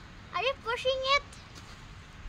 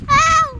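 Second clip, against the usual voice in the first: loud yelp of pain; sharp ow of pain; 4 semitones higher, 13 dB louder than usual. Real, not acted. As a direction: cry out as if hurt. Child hurt, loud cry.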